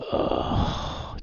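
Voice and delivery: long, breath-heavy groaning sigh; reluctant exhaled ugh; weary sigh. Acted. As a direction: sigh at an unwelcome greeting. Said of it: "just kinda sighs".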